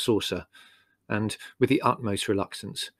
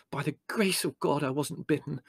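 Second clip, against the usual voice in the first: softer, higher voice of someone just spared; shaken relief; 4 semitones higher, 5 dB quieter than usual. Acted. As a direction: count your escape, voice raised in pitch but soft. Larry after narrow escape.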